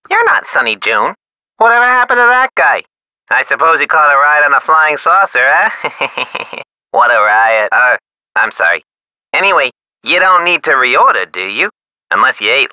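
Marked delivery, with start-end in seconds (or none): 5.8-6.6 soft, breathy run of chuckles; snickering laugh. Acted. Supplier after his flying saucer joke, soft snicker. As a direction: snicker quietly at your own joke.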